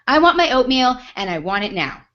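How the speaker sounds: loud, high entitled demand; mock entitled customer stamping her foot. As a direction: imitate her insisting on it now.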